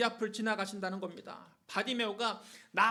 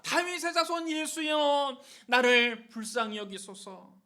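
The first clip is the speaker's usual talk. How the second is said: calling out; 2 semitones higher, 4 dB louder, slower than usual. Acted. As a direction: call out for mercy with long vowels. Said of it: "예수님을 불렀습니다". (높은 소리로 길게 부르짖기)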